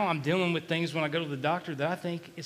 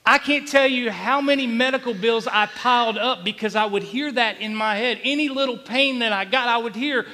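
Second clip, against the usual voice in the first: loud, higher-pitched testimony; urgent, raised pulpit delivery; impassioned preaching; 6 semitones higher, 7 dB louder than usual. Real, not acted. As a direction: preach it with mounting force. Preaches at full force.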